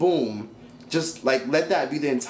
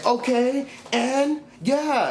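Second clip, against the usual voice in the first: much higher, sassy mimicry; mocking Bianca; 10 semitones higher, much slower than usual. Vlogger mimicking Bianca in the argument, high and sassy. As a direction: toss it off in a high snooty voice. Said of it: "Bianca starts saying".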